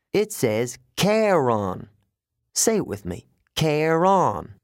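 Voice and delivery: slow "Charon" with both syllables drawn out; "Charon" stretched out, full "on" at the end; teaching a name. A narrator's reading. Guard makes the boy repeat "Charon", slowly drawn out.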